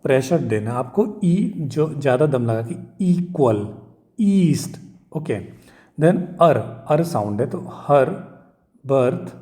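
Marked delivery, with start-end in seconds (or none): 4.2-4.8 east with the ई pushed high and long; pressure on ई. Shown as a demonstration. Teacher showing students the pressure on ई, stressed. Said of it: "तो ई पे आपको pressure देना".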